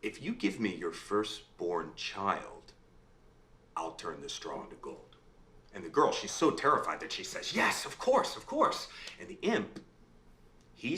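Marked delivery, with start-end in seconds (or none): 0.0-5.2 sly imp. Acted. Imp bargains for firstborn child. 7.5-8.9 terrified. Acted. Terrified girl agrees to imp.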